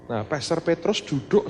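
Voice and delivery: ordinary talk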